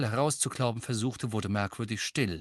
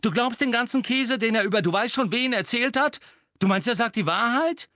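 incredulous angry challenge; 11 semitones higher, 5 dB louder than usual. A narrator's reading. Seamus incredulously challenges Ron.

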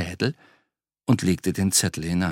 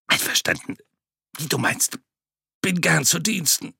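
strained rasp; 7 semitones higher than usual. Acted. Throttled butcher agreeing.

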